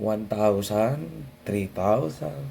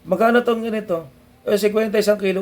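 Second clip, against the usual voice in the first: higher, louder quick shop exchange; a little store haggle voiced higher; buyer and vendor skit; 10 semitones higher, 5 dB louder, faster than usual. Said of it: "Bibili ka sa tindahan".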